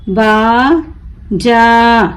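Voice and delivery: बाजा very slow, long बा, pause, long जा; broken into syllables. Shown as a demonstration. Teacher breaking the word for learners, बा... जा stretched apart. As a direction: draw out बाजा as two separate syllables. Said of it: "तोड़ तोड़ कर पढ़ूंगी".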